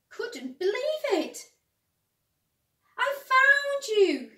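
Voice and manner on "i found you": very high, loud joyful cry. A narrator's reading. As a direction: cry out with delight. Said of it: "he cried".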